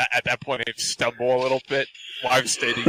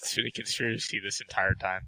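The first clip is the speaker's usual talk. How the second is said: whispering; 3 semitones lower, 9 dB quieter than usual. Acted. Joking conspiracy remark.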